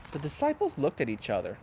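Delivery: ordinary delivery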